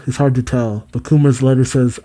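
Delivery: ordinary talk, nothing marked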